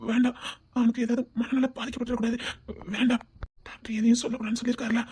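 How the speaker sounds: quick hushed whisper. Acted. Warning Meena about the doctor's orders, whispering fast. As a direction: rush it out under your breath. Secret kept from confused man.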